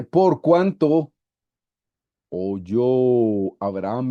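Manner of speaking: very slow, emphatic scripture reading; lectura solemne de la Biblia. Acted. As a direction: stretch out each phrase of the verse. Lee un versículo en voz alta. (lectura bíblica pausada y enfática)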